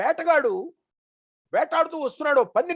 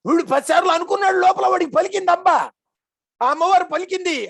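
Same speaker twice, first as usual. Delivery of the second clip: higher and louder, fast and thrilled; excited proclaiming; 6 semitones higher, 6 dB louder than usual. Acted. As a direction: proclaim it, rushing with wonder. Excited climax of revelation.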